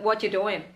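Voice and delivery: 'What you doing' as quick 'whatchu doin'; short, linked 'What you doing'; casual reduced form. Shown as a demonstration. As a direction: toss off 'What you doing' as one chunk. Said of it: "Tapi kalau sekedar iseng".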